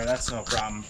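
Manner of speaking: usual speaking voice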